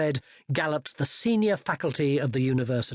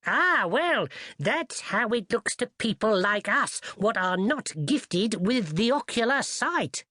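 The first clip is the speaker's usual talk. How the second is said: put-on higher, knowing old servant; 7 semitones higher than usual. Old servant explaining wizard business to the lad, higher put-on voice. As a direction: explain with humble self-assurance.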